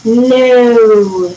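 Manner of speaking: long drawn-out "No"; long answer. A narrator's reading. Dad gives his long answer, a drawn-out "No". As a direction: stretch the vowel of "No" right out. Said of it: "long answer".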